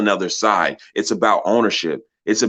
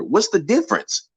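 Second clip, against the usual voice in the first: sharp rising what's the difference; indignant question; 10 semitones higher than usual. Real, not acted. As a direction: pitch jumps much higher in disbelief. Rhetorical challenge to critics.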